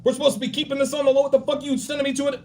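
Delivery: high, fast mock tirade; hammed-up, fast, high, angry rant; twitchy angry rant. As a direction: rattle it off like a jumpy hothead.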